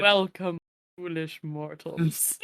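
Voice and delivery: slow deep ghost host voice; booming demon lord. Retold or imitated. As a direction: intone it like a spooky host. Quotes Haunted Mansion opening.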